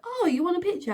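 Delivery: high-pitched, lightly acted realisation; imitated surprise. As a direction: mimic a sudden "oh!" offer. Acting out Dougie offering a picture.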